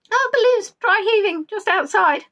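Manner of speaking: ordinary talk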